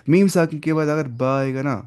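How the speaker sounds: ordinary talk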